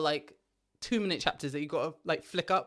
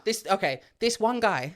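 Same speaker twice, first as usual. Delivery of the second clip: ordinary talk, nothing marked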